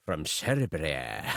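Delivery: slow, low Scottish drawl; Scottish accent. Shown as a demonstration. Reading a listener's letter about his friend, in a Scottish accent. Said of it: "I'm doing a Scottish accent again for Serbia".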